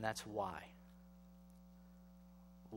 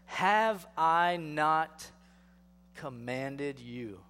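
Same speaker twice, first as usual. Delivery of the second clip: drawn-out, very slow, louder rhetorical question; sarcastic; 6 dB louder, much slower than usual. Acted. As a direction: let the sarcasm hang on each word. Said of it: "You can hear a little sarcasm in God's voice".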